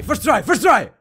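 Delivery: normal talking voice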